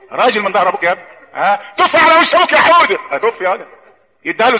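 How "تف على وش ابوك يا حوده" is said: high, delighted-parent imitation; mock egging-on parent. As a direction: cheer a small child on to misbehave. Parent eggs on child, mocked. (تقليد أب بيشجع ابنه بصوت عالي)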